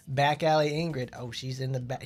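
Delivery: normal talking voice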